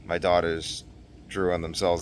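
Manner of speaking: normal talking voice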